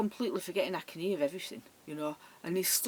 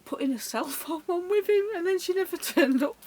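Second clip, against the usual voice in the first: high, louder, breaking into laughter; amused telling; 11 semitones higher, 6 dB louder than usual. Real, not acted. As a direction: laugh your way to the punchline. Laughs at friend's trick.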